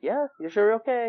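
retold check-in, pitched well up; friendly concern. Friends checking on him, imitated high and concerned. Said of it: "And they're like".

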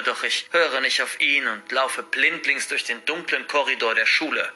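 ordinary delivery

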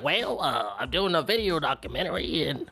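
mocking impression with a put-on voice; silly-voiced documentary guy. Interviewer explaining his video documentary, mocked as silly. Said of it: "every other time he just sounds silly".

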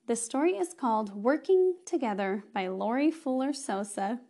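normal talking voice

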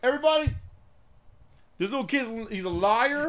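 mock public shaming; calling him out. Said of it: "You call him out".

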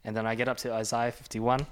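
usual speaking voice

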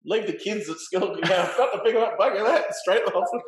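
mock the workmate rushing off in a flap; mocking panicked workmate. Patient mocks scared workmate, loud and high.

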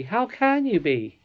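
a touch of disbelief, high and drawn out; slow, high-pitched puzzled exclamation; astonished exclamation. A narrator's reading. Curdie questions how she can be glad, mildly astonished. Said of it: "exclaimed Curdie".

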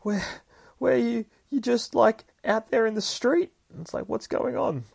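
high, loud excited outburst; excited kid. Retold or imitated. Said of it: "Gabby gets all fucking excited".

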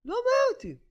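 high, strained cry of resolve; voicing the officer swearing off his job; officer's defiant vow. Officer to rabbi, defiant high-pitched cry. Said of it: "הוא אומר לי".

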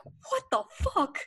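high, slow, stunned disbelief; a sudden high squeal of shock; shocked what the fuck. Retold or imitated. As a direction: react like you can't believe your eyes. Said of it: "and I'm just like".

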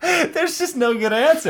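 very high, laughing squeal; slow, giggled-out admission; real laughing. Real, not acted. Conceding the sword predicament to friends, high and laughing.

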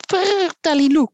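trilled, high-pitched bird cry; trill, then sing out like a bird; bird call. A narrator's reading. Bird sounding above the boy's head, high trilling call. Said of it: "klonk het boven zijn hoofd".

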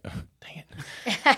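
dejected whisper, then a high squeak on it; a hushed, deflated whisper; whispered disappointment. Retold or imitated. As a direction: mutter it under your breath like a dad let down. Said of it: "And I'm like".

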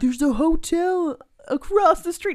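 put-on high, loud, slow kid voice; mock the creepy little kid. Viewer imitating the kid in the kissing booth in a high, loud, slow voice. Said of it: "he's like".

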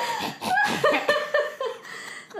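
high raspy witchy cackle; witch cackle. Retold or imitated. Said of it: "a weird raspy cackle. She sounds like a witch".

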